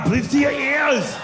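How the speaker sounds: high, drawn-out action-star shout; Arnold Schwarzenegger. Acted. Comic playing the action star, exaggerated impression. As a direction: belt it like a movie action hero. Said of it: "I actually met Arnold Schwarzenegger".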